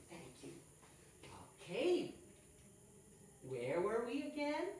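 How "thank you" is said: whispered thanks. Real, not acted. Aside after checking with student.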